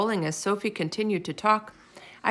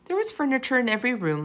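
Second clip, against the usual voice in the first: plain reading voice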